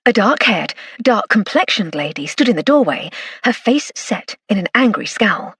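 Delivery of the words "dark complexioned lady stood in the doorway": usual speaking voice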